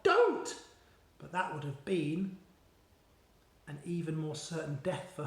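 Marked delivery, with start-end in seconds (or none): 0.0-0.5 much higher, louder cry of Don't; a girl's sudden scream. A narrator's reading. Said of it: "Sophie wanted to scream out".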